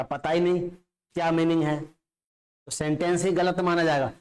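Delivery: ordinary delivery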